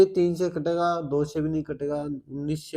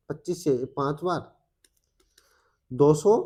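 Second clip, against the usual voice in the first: ordinary talk, nothing marked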